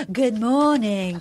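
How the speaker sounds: slow, drawn-out cheery greeting, imitated; pottery teacher impression. Host mimics pottery teacher greeting cranky Rachel, drawn-out greeting. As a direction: stretch it out like an instructor greeting a class. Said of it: "this woman's like".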